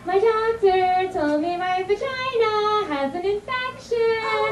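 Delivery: put-on high, slow sing-song little girl. Comic imitating a little girl announcing her infection in sing-song. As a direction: chant it like a tattling five-year-old. Child voice in retold scene.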